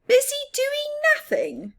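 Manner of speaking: hammed-up scoff at lazy mouse; grumble. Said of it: "grumbled Big Mouse".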